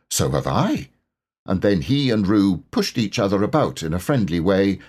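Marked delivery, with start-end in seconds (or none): 0.1-0.9 a hint of a lower Tigger voice; lightly voiced Tigger. A narrator's reading. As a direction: Tigger matching Roo, a bit lower.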